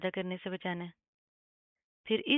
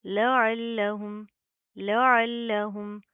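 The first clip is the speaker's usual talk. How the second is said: slow, louder with firm doubled ल; tajweed recitation; 5 dB louder, much slower than usual. Shown as a demonstration. Teacher reciting with shadda for students. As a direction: press the doubled ल firmly. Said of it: "सख्ती से जमा कर पढ़ेंगे".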